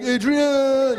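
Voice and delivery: Rocky impression. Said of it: "Like Rocky".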